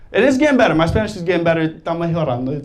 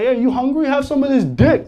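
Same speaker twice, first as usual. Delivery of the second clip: thug; 7 semitones higher than usual. Retold or imitated. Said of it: "walking up to girls being like".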